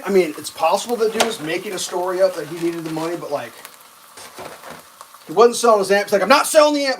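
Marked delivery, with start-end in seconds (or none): 6.2-7.0 loud, high, indignant refusal; raised-voice outburst, imitating the seller; retold yell. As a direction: act out a seller yelling his refusal. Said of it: "Like, he yelled that".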